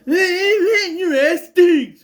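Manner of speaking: high, loud, drawn-out jeering wail; mocking taunt. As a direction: imitate an obnoxious bully's slow taunt. Imitates Mickey's taunt at Alan.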